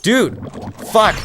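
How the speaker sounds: frustrated outburst. Real, not acted. Hit by enemies while playing.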